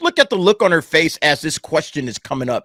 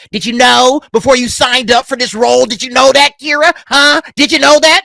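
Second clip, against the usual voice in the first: pushy reporter; 6 semitones higher, 6 dB louder than usual. Retold or imitated.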